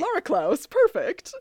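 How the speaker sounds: gleeful approval; delighted. Real, not acted. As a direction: savor the nickname happily. Delighted echo of the nickname.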